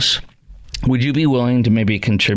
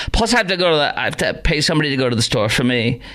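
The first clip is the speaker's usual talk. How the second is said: ordinary talk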